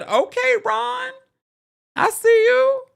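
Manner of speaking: very high, loud falsetto squeal; playful hype. Retold or imitated. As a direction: hype him up in a sky-high voice. Playfully hyping Ron's orange coat.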